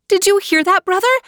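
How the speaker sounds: put-on high, pleading young girl voice; high young sister pleading; girl calling out. A narrator's reading. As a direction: call to your brother through the wall. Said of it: "said Natalia".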